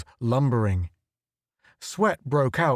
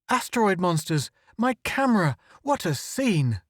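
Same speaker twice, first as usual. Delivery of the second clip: high eager exclamations; thrilled despite danger; excited; 7 semitones higher than usual. Acted. Photographer thrilled by monsters, high eager cry.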